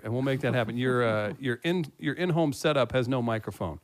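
ordinary talk

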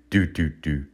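low, soft, slowed heartbeat noise; slowing heartbeat. Retold or imitated. Comic imitating weed slowing his heart, low sluggish thumps. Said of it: "the weed is slowing it down".

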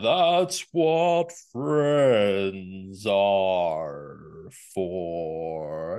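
slow, low lazy tiger drawl; lightly voiced villain tiger; Shere Khan voice. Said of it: "a Treebeard and a Shere Khan impersonation".

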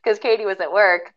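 normal talking voice

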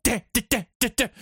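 short staccato "deh" bursts like horror-score strings; vocal film-score stings; imitated violin stabs. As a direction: mimic suspense violins stabbing. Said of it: "when the violin stabs start".

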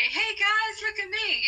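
mock self-promo. Comedian mocking online self-promotion, chirpy and eager. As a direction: play a cheesy self-promoter. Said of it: "is go".

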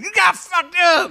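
high, slow mimicked shout; loud high-pitched mock yell; Jeff Beach. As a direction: imitate a rowdy friend hollering. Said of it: "that guy just saying".

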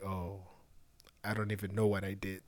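soft confused mumble; quiet bewildered. Retold or imitated. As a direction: say it low, puzzled. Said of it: "it's like".

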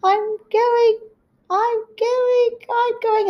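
very high, drawn-out mock wail; high-pitched pretend cry of alarm; imitating sliding mother. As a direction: repeat it in a high comic wail, like slow motion. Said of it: "She's like".

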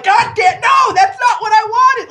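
put-on high, loud, whiny spoiled-teen shout; temper tantrum. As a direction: throw a bratty fit, loud and high. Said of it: "I feel like I'm throwing a temper tantrum".

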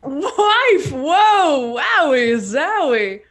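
loud, high startled whoa; surprised. Acted. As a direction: blurt it out in shock. News of Nib's wife.

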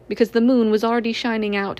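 usual speaking voice

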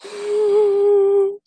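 sharp gasp. Real, not acted. Caught by the killer.

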